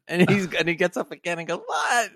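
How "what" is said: high-pitched mock "what"; startled patient. Patient hears his foot will be cut off, mock startled. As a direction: jump up in pitch, playing alarmed. Patient gets up again, alarmed.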